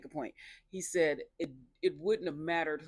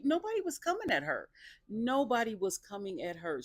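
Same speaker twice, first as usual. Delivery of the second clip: high stress on NOBODY; exasperated viewer insisting on nobody; 7 semitones higher than usual. Real, not acted. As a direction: hammer NOBODY home. Insisting, repeated.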